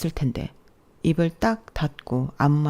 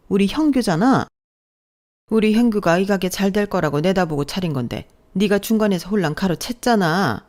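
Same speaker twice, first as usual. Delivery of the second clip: raised, indignant scolding; angry mother-in-law; 3 semitones higher, 4 dB louder than usual. Acted. Mother-in-law berates daughter-in-law over the shop, angry. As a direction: berate her as an ungrateful upstart.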